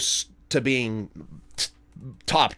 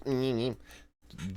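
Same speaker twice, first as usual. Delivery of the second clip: mimicked chasing noises; 11 dB quieter, much slower than usual. Imitating dog chasing laser pointer.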